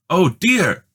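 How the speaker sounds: high, loud alarmed cry; frightened cry. A narrator's reading. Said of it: "she cried".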